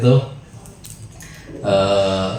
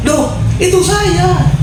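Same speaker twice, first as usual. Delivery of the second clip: surprised reveal; much slower than usual. Retold or imitated. Old man realises he's the uncle.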